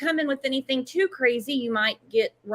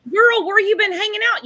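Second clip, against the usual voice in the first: higher, louder playful call-out; excited teasing; 8 semitones higher, 6 dB louder than usual. Real, not acted. Host teasing the guest for holding back, excited and playful. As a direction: tease her like a delighted friend.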